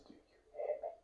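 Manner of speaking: whispered poetic refrain. Acted. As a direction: breathe the question in a whisper.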